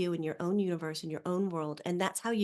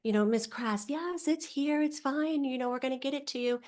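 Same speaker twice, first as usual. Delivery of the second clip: mock sweet customer rep; 7 semitones higher than usual. Customer rep on the phone being nice as all get out. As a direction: play an overly nice rep. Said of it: "And they're like".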